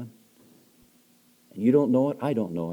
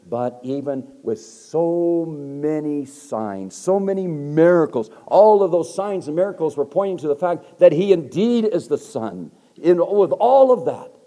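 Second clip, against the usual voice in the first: preaching at full force; 7 semitones higher, 8 dB louder than usual. Acted. Preacher to congregation, preaching at full force. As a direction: swell it like a revival preacher.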